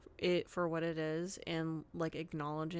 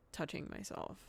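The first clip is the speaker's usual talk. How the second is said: embarrassed near whisper; 4 semitones lower, 10 dB quieter than usual. Real, not acted. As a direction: drop to a whisper, a bit sheepish. Embarrassed admission, voice drops.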